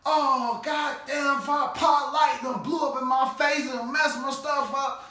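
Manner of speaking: high, loud mock shout. Burnt dad yelling to the family, high loud mock shout. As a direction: yell it like a scorched, outraged dad.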